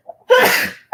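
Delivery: a sudden high cough; sharp cough. Real, not acted. Coughing mid-stream, then excusing herself. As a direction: cough once, hard. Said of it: "Excuse me".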